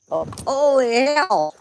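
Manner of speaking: sudden high, loud outburst; startled exclamation. Real, not acted. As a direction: jump at the shock. Startled by leaping cat.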